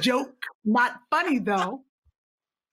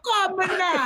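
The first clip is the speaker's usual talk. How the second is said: mock Caribbean accent; 5 semitones higher, slower than usual. Echoing the mock accent attempt.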